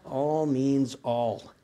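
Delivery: All drawn out very slowly, each word weighted; stress on all. Shown as a demonstration. Pastor pressing the congregation on the word all. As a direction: lean hard and slow on All. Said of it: "Can you say all?".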